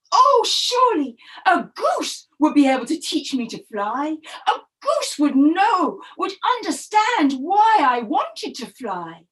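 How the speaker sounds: hope aloud, a touch of a wail; hopeful turtle. Acted.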